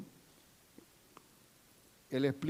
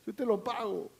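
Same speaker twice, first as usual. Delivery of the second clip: pleading debtor; 6 semitones higher, 4 dB quieter than usual. Acted. Debtor promising again.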